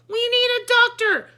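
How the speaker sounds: high loud urgent shout. A narrator's reading. The photographer calling for help, a high urgent shout. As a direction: call out for help. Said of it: "shouted the photographer".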